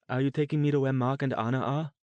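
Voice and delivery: blurting the question, scared for her family; fearful tremor. Acted. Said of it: "tried to keep the fearful tremor from her voice".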